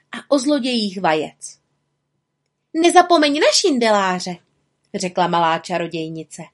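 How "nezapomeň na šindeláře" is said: high-pitched piping reminder; high little-witch character voice; eager little witch. A narrator's reading. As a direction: chime in high and keen. Little witch prompts her accuser.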